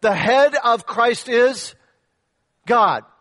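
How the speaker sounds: slow, weighty phrase with a pause before God; slow emphatic stress on head and God; stressed slowly. Shown as a demonstration. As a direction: land each word, pause, then drop God. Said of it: "don't miss the last part of that".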